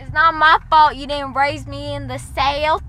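bad Southern accent. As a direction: lay on a clumsy Southern twang. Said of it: "That's the worst Southern accent".

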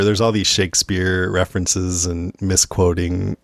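ordinary delivery

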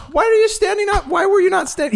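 high-pitched exasperated disbelief; incredulous outburst. Retold or imitated. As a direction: squeal it in mock disbelief. Retelling his incredulous outburst at a sitter.

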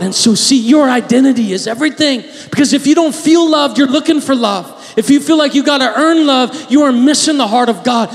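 loud, high, impassioned preaching; fiery sermon climax; preaching at full force. Acted. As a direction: build to a fervent shout.

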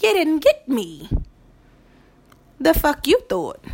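triumphant, in-your-face taunt. Real, not acted. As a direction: gloat loudly that they didn't get you. Gloating at a foe.

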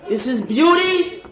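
high, slow, laughing scorn; sarcastic mockery. Rabbi mocks secular teachers' praise of fairy tales, laughing sarcasm. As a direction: ham up the enlightened ones' pride.

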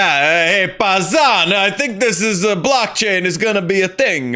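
a cartoonish Italian New Jersey mobster accent; bad Italian New Jersey accent. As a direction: play a Sopranos-style Italian New Jersey guy, badly. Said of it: "that was a really bad Italian New Jersey".

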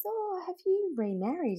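high, light, quiet curious question; a teacher politely fishing for news. Retold or imitated. Teacher quizzing the mother, softly curious. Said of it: "she came up to me and she says".

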